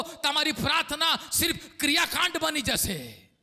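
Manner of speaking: usual speaking voice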